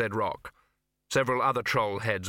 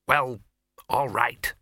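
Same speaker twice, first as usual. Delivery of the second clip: conceded; 4 dB louder, much slower than usual. A narrator's reading. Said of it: "she conceded".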